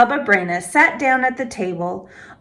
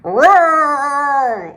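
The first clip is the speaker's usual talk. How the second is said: big growling roar; bear roar; 5 semitones higher, 4 dB louder than usual. A narrator's reading. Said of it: "Old Bear roared".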